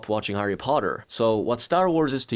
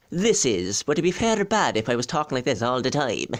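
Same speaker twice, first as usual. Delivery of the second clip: dropping into his own Irish accent; real Irish accent; 3 semitones higher than usual. Shown as a demonstration. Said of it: "this is not even my accent".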